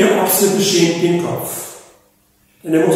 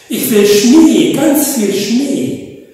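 slow, louder longing for snow; enraptured; 5 semitones higher, 5 dB louder, slower than usual. Acted. Polar bear raves about snow: slow, enraptured. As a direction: savour each word, dreamy and louder. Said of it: "Und er schwärmte verzückt".

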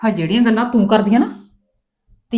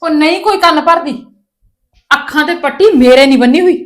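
much higher and louder, exasperated yelling; furious shout; 6 semitones higher, 9 dB louder than usual. Acted. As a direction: shout at her to make her understand. Mother yells back at daughter. (ਗੁੱਸੇ ਵਿੱਚ ਚੀਕ ਕੇ)

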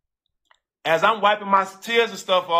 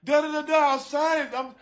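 high, wailing sobbed pleading, much higher; a fiancée in a wedding dress, crying as she gives in; imitating her crying; 9 semitones higher than usual. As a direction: mimic her sobbing through the words, pitched way up. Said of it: "she hard down crying".

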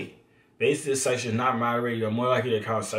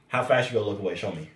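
ordinary talk

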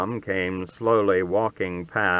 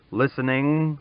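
usual speaking voice

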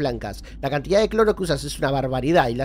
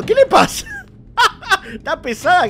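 very high squeal into laughter; shrieking laughter; 13 semitones higher, 6 dB louder than usual. Real, not acted. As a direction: crack up in a squeal. Laughing at ally's trouble. (risa aguda y chillona)

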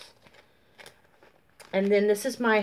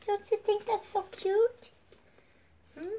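put-on baby talk to pet; 11 semitones higher, 4 dB quieter than usual. Asks her dog Max.

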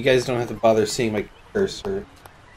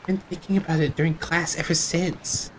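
fake schoolgirl voice; 5 semitones higher, 5 dB quieter than usual. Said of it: "best anime schoolgirl voice".

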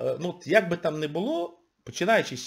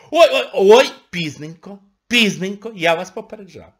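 mock gloating; 5 semitones higher, 7 dB louder than usual. Real, not acted. Gloating I-told-you-so.

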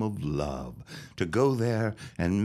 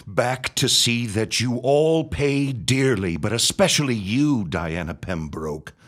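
louder, higher menacing vow; vengeful threat; 4 semitones higher, 5 dB louder than usual. Acted. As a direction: promise them payback with relish. Villain vows revenge.

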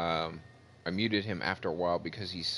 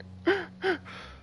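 high, breathy sob-gasp mimicking a crying kid; imitated sobbing gasp. Mocking a crying kid on mic, high sobbing gasp. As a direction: gasp like a kid choking on tears. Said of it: "just going".

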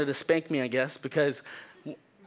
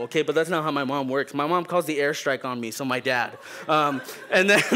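ordinary talk, nothing marked

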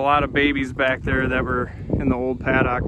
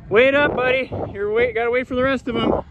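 calling the dog; 10 semitones higher than usual. Real, not acted. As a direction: call Skipper across the field.

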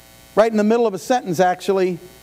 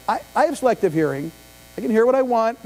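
ordinary talk

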